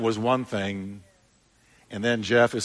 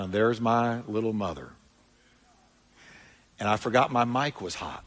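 normal talking voice

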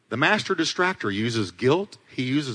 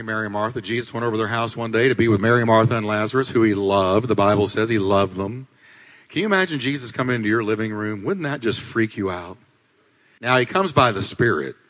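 ordinary delivery

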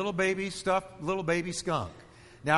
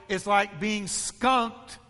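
drawn-out, each word weighed, much slower; slow emphatic preaching; much slower than usual. Real, not acted. Preacher to congregation, on being offended: very slow emphatic preaching. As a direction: lean hard on the image, slowly. Preacher drives home skunk image.

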